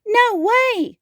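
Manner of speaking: high, squealed refusal; high-pitched childlike protest; crying out. A narrator's reading. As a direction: refuse like an upset little dinosaur. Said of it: "cried Tinyosaurus".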